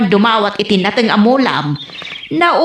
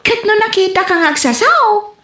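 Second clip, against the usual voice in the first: 1 lightly voiced mother; 8 semitones higher than usual. Mother voiced teasing her child.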